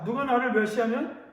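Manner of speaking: ordinary talk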